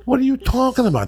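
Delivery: very high, disbelieving question; incredulous protest. Acted. Actor balks at the union caller's fee, incredulous.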